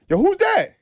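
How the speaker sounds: mock high surprised call; imitating a dude. Dude in the back spotting him, high surprised call. Retelling dude's reaction in back.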